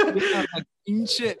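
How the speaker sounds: high laughing yeah. Real, not acted. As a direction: burst out laughing into it. Laughing at friend's story.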